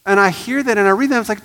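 loud, high, driving sermon voice; rising to full preaching force. Acted. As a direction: preach it hard to the congregation.

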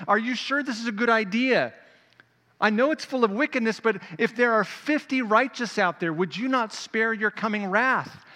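high, loud, urgent plea; pleading Abraham. Acted. Abraham questioning God about destroying Sodom, urgent loud plea.

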